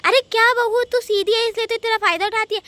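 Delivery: loud, high exasperation; exasperated scolding. Acted. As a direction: berate her at full volume. Mother-in-law berates elder bahu. (झुंझलाकर ज़ोर से डाँटना)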